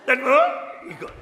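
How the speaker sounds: high, amused retelling through laughter; laughing. Real, not acted. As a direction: barely get it out for laughing. Laughing at Swami's mock praise.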